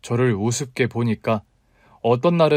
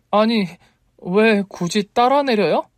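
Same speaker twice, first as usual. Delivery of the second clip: high, slow, put-out question; indignant challenge; 9 semitones higher, 3 dB louder than usual. A narrator's reading. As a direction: challenge him, drawn out and high. 따라 내린 남자에게 따짐. (높은 목소리로 느리게 따지듯)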